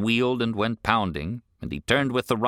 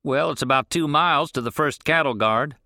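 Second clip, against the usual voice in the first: put-on older rancher, higher and pushier; gruff rancher; 6 semitones higher, 4 dB louder than usual. Rancher questions the boy on the road, irritated. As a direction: demand an answer, irritated.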